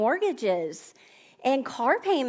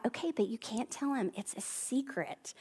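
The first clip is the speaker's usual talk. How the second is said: hushed secret; 11 dB quieter than usual. Retold or imitated. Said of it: "and I was like".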